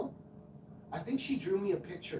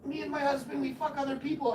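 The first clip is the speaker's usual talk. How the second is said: high neighbor woman voice; 10 semitones higher, 3 dB louder than usual. Retold or imitated. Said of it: "And she goes".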